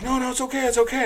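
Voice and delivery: high, hurried mock placating; high-pitched peacekeeper imitation; running interference. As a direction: imitate people pleading to calm things. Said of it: "all trying to run interference".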